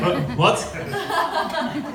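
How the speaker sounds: high, breathy baffled what; a laughing, puzzled double take; confused what. Retold or imitated. Confused reaction to name.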